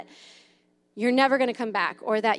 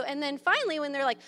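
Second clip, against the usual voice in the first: ordinary talk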